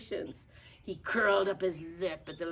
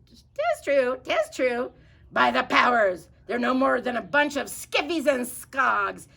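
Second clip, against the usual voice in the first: parrot pirate voice; 5 semitones higher, 9 dB louder than usual. Acted. The parrot agreeing with Pete, loud and high. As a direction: growl it like an old sea dog. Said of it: "said the pirate".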